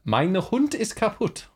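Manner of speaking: slow, high mock German; strong German accent. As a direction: drag it out in put-on German. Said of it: "a really strong accent".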